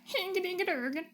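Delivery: high, sing-song mock Swedish chef gibberish. Streamer naming her pet in a Swedish chef voice. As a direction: bounce it like the Muppets' Swedish chef. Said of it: "my Swedish chef".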